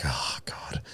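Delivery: breathless whispered groan, worn out; exhausted whisper. Retold or imitated. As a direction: sigh it out in a whisper. Retelling his tired reply to Nikita.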